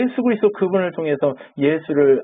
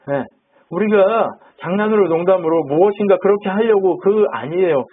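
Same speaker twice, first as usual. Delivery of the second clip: ordinary talk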